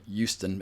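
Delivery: "Houston" without its H, like YOU-ston; "Houston" starting on a Y sound; old boss's pronunciation. Retold or imitated. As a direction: imitate the boss saying "Houston" as YOO-stun. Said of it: "as my old boss used to say".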